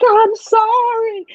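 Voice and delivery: high, drawn-out apology; Rain Man. Retold or imitated. Mother imitating her son on the spectrum after milk spilled, slow high apology. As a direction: imitate a child's slow, high-pitched sorry. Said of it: "he's like Rain Man".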